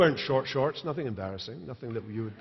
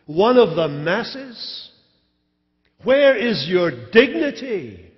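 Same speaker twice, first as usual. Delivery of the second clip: higher, louder, slow reproach; wife rebuking her husband, raised and scornful; scornful scolding; 6 semitones higher, 7 dB louder than usual. Acted. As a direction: berate him, voice raised high. Michal scolding David's dancing.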